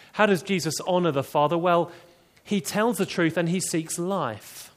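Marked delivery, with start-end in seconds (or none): none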